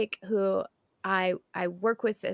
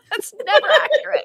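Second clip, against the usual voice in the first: loud, high words through laughter; laughing; 11 dB louder than usual. Real, not acted. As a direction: crack up while saying it. Cracking up over the point.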